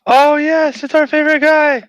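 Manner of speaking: loud, very high-pitched delighted cry; excited cheer. Real, not acted. Player greets the party's favorite guy, excited cheer. Delighted at a known character's arrival.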